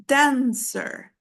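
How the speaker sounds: "dancer" with a long, strong DAN and lighter cer; stress on first syllable. Shown as a demonstration. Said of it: "is stronger. So here we say".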